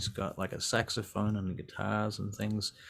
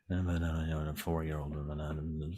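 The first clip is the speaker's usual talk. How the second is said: low, soft indistinct mumbling; overheard talking; 5 semitones lower, 7 dB quieter than usual. Pretend. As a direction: mumble a half-heard conversation from next room. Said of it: "you hear him talking to someone".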